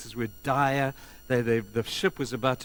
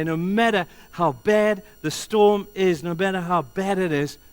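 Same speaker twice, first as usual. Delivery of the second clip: loud, raised, emphatic declaration; powerful assurance; 6 semitones higher, 8 dB louder than usual. Retold or imitated. Paul assuring the crew despite the storm, powerful declaration. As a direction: drive it home with force. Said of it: "Paul had the inton-, intonation".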